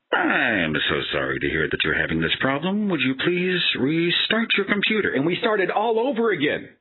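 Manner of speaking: same script again, different drone; next support agent. Retold or imitated. New technician restarting the whole script, high and whiny.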